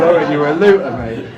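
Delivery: loud, mocking jab at the bloke; teasing shout. Teasing a Brunel actor at a museum, loud mocking shout. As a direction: holler it like a cheeky jibe. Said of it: "I said".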